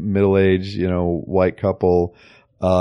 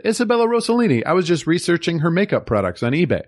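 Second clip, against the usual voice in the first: high-pitched quote; 8 semitones higher than usual. Retold or imitated. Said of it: "Carla said".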